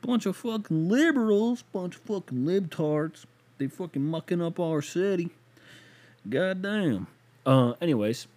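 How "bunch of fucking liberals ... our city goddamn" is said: a hammed-up Southern accent griping about liberals. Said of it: "that fucking Southern voice".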